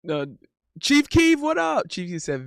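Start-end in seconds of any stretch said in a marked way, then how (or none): 0.8-1.9 high shouted what up; real hype greeting; excited greeting. Real, not acted. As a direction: call out to the chatter. Greeting a chatter.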